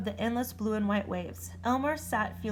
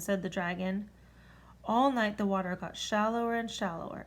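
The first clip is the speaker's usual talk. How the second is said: ordinary delivery, nothing marked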